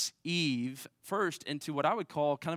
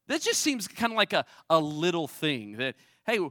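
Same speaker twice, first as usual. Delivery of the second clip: mock doubting voice, higher and louder; skeptical objector; 3 semitones higher, 4 dB louder than usual. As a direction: voice the doubter who finds it petty. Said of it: "heard someone else say this and say".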